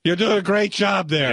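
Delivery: put-on lightly slurred drunk praise; a hint of the tipsy caller. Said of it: "the drunk voice".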